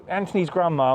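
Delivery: ordinary talk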